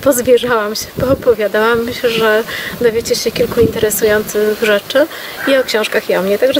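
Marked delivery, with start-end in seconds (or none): none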